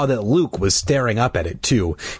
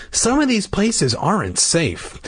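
higher, mildly worried mother; fretful; 9 semitones higher than usual. A narrator's reading. Mom warning about unsafe places, fretful. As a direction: worry aloud, pitched up. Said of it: "she said fretfully".

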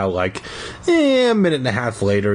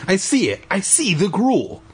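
1 excited high cry; 9 semitones higher than usual. Acted. Brother excited discovery.

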